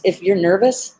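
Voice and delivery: ordinary talk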